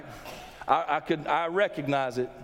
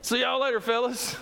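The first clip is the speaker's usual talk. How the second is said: higher, casual see-you-later; Jesus breezily leaving the stirred-up priests; breezy goodbye; 5 semitones higher than usual. Pretend. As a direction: toss it off lightly, walking away. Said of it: "it says, "And then he left".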